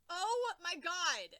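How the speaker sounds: slow, loud, drawn-out exasperation; exasperated. Real, not acted. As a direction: groan it out slowly. Exasperated reaction to the story.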